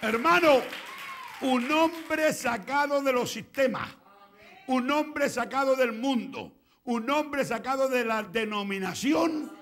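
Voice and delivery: loud, high, slow proclamation; full-force preaching. Real, not acted. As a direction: shout it to the congregation in driving repeats.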